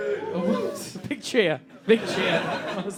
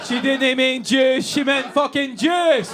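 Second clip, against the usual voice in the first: slow, raised Scottish shout; drawn-out, louder Scottish taunt; jeering Scottish accent; 4 semitones higher, 3 dB louder, slower than usual. Acted. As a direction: holler it in broad Scots. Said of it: "the Scottish people".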